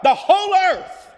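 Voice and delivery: exultant angelic cry. Acted. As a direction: lift The whole earth up in a loud cry. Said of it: "causes them to cry out".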